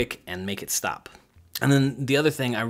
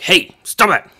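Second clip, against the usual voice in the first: sharp loud higher shout; aggressive tone; 7 semitones higher, 10 dB louder, slower than usual. Shown as a demonstration. Coach shouting at a misbehaving kid, aggressive and loud. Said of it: "an aggressive tone of voice".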